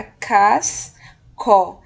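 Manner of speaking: CASCO slowly as CAS, pause, CO; slow, emphatic two-beat CASCO; syllable by syllable. Shown as a demonstration. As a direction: hold the S in CASCO before a separate CO. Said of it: "Como vai ficar essa primeira sílaba?".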